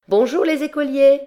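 high, loud and teasing; a cheeky girl calling up to the window; funny little voice. A narrator's reading. Cheeky laundress calling up to the schoolboys in a funny little voice. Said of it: "d'une petite voix drôle".

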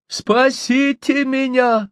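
high, drawn-out plea; frantic cry for help. A narrator's reading. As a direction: cry out desperately, stretching the words high. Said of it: "вдруг истошно закричал он".

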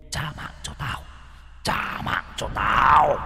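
whispered, then slow drawn-out demand; vengeful ghost. Acted. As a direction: hiss it like a spirit come for revenge.

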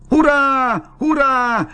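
very high, jubilant shout; sudden loud cheer. A narrator's reading. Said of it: "riep hij opeens heel hard". (luid en hoog juichend)

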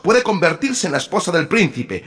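high, loud stern mother; wicked stepmother. A narrator's reading. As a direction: cut the squabble short. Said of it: "intervino la madre".

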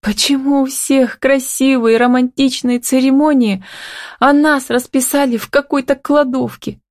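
high, plaintive voice holding back tears; thin-held upset, pitched well up; tearful babble. A narrator's reading. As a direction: complain in a high little voice, fighting for composure. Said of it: "пролепетала Милка, пытаясь совладать с собой".

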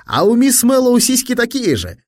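put-on high, loud boyish tease; teasing boy. A narrator's reading. Fat boy teasing his tennis partner, gleefully high-pitched. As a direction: pipe it up high to needle a pal.

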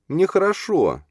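high-pitched acted whimper; high, tearful character voice; tearful sob. A narrator's reading. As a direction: insist through mock tears, voice way up. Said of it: "всхлипнула она".

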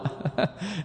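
soft, short chuckle; brief amused chuckle. Real, not acted. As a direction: let out a quiet little laugh. Lecturer chuckles at his exchange.

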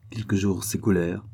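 plain reading voice

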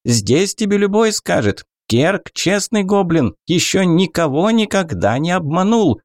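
high, indignant goblin. Acted. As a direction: protest like an offended goblin, pitched high. Goblin vouching for himself.

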